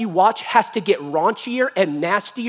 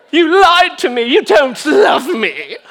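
high, loud hammed-up wail; wounded outburst; 9 semitones higher, 8 dB louder, slower than usual. Delilah guilt-tripping Samson, hammed-up loud wail. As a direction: wail the accusation, dragging it out.

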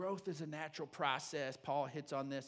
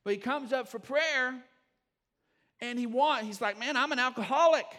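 louder and higher as the story builds; building preaching; 7 semitones higher, 11 dB louder than usual. Acted. Pastor to congregation, preaching the story with rising fervor. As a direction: lift the story to the pulpit's pitch.

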